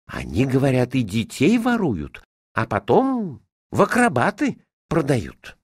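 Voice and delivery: put-on complain, then fret over your child; fretting mistress. A narrator's reading.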